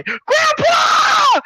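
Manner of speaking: very high drawn-out yell; shrieked, stretched-out call; calling for help. Retold or imitated. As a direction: scream for rescue, hammed up. Character calls out for grandpa.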